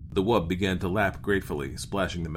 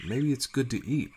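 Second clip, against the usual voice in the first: higher-pitched, tentative suggestion; uneasy crewman's timid voice; nervous; 6 semitones higher than usual. A narrator's reading. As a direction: offer it timidly, without raising volume. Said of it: "Peterson said nervously".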